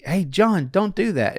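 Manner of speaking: a light parental tell-off; scolding a child. Retold or imitated. Parent telling little John to stop, mild mock warning. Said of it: "you'd say".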